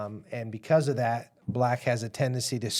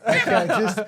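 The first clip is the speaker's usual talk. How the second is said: high, louder talk through laughter; laughing; 7 semitones higher, 5 dB louder than usual. Real, not acted. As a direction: keep talking while cracking up. Hosts cracking up over the note.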